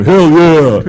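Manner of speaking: loud, high whoop of approval; enthusiastic yell. Pretend. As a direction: cheer it like a fan. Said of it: "there'd be one just going".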